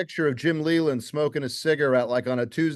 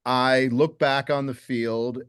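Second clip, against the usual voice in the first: ordinary talk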